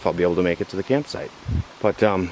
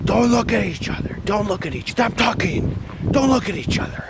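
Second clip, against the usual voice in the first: much higher, strained screeching orders; imitating yelling; 14 semitones higher than usual. Leader yelling at teens not to look, high mock screech. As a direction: screech the rules at misbehaving kids. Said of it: "She just started yelling at them".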